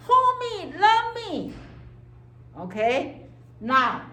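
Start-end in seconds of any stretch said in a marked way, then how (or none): 0.1-1.5 put-on high, loud pleading baby voice; needy baby id. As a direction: beg like a crying infant. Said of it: "because your id say".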